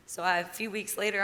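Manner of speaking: usual speaking voice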